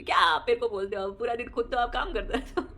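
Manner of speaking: loud, laughing mock scold of mother-in-law. Daughter-in-law scolds her mother-in-law for working, in a loud laughing mock scold. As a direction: tell her off, half laughing. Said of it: "मैं उनको डांटती होगी".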